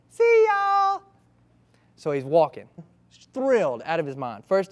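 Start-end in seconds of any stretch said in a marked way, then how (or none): 0.1-1.0 put-on high, loud cheerful kid goodbye; little boy. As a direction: call out like a happy five-year-old. Boy leaves for school.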